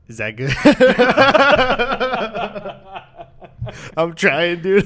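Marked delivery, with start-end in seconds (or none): 0.6-3.9 long high burst of laughter. Real, not acted. Laughing at his own bad slogan, long high laugh.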